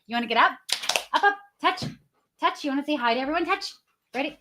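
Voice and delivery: high-pitched cooing to a pet; dog-talk voice. Real, not acted. Host invites her dog onto the live, dog-talk voice.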